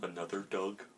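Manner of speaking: low, soft character voice; Korg. Acted. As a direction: drop it low and quiet, in character. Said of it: "I'll try my Korg".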